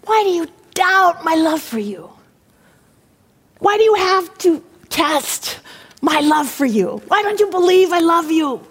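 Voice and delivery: high, anguished divine appeal; plead, rising to a high cry; impassioned pulpit voice of God. Acted.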